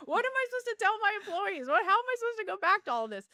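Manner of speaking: high, loud, exasperated mock rant; retold angry shouting. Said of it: "more angry and louder".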